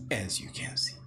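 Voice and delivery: quick aside, whispered. Real, not acted. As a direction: drop to a whisper to the camera.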